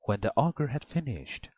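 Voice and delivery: plain reading voice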